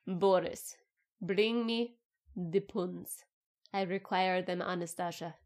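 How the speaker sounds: hammed-up Russian accent, lightly done; bad Russian accent. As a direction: play a mock Russian aristocrat, Russian accent. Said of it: "I'll stop my bad accent now".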